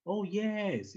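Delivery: a sudden high, surprised oh, yes. Shown as a demonstration. Teacher showing how to sound surprised in German. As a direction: sound caught off guard. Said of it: "if you wanna make it like a surprise".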